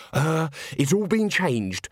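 slow, pressing urgency; urgently. A narrator's reading. Agnes urges the nobleman, slow and pressing. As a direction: lean on it, slowly urgent. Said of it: "said Agnes urgently".

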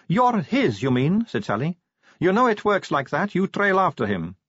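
put-on high, breezy young woman voice; chatty, light-hearted vampire girl. Sally voiced.

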